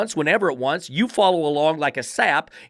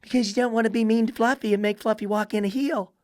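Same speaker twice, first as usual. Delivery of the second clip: higher mocking tone about Fluffy; 7 semitones higher than usual. Mocking owner's softness.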